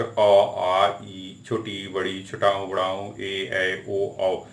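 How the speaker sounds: reciting the vowel sounds one by one, very slowly; slow vowel list. Shown as a demonstration. Teacher listing the Hindi vowel sounds to the class, slowly. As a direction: count off each vowel sound slowly and clearly. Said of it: "अगर ये sound निकल रहा है".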